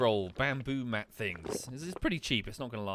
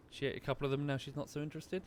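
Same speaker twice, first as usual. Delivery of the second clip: ordinary delivery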